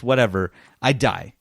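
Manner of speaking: ordinary talk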